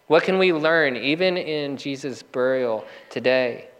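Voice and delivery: ordinary talk, nothing marked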